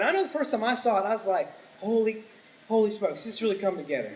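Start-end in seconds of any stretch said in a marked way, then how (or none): none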